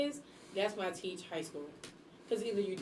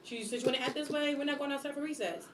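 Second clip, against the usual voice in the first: higher-pitched, mildly scolding teacher; stern teacher warning; 7 semitones higher than usual. Acted. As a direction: lay down the consequence to a misbehaving kid. Acts out warning misbehaving kids.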